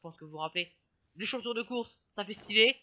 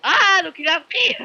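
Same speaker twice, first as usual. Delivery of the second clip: surprised; 6 semitones higher, 10 dB louder, slower than usual. Real, not acted. Frog hit by rain.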